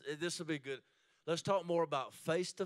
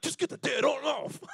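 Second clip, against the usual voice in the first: wife's voice, higher and louder, mock annoyed; mock shout; 8 semitones higher, 9 dB louder than usual. Wife mock-shouting at husband's stiff one-armed hug. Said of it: "She be like".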